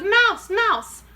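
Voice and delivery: loud, high alarmed shouts; shouting. Acted. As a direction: cry out spotting a mouse. Imagined shouts of witches.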